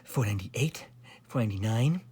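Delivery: tired voice. A narrator's reading. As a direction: count as if worn out from stairs. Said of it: "broke in the tired voice of Sir Alaric".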